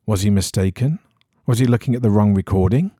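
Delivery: ordinary delivery, nothing marked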